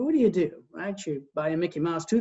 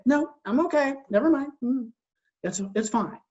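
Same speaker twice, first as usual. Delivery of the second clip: high, strained mock "I'm fine"; passive brush-off; 6 semitones higher than usual. Husband brushing off his wife's question, falsely cheerful. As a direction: fake it being fine, covering hurt. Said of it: "And I'd say".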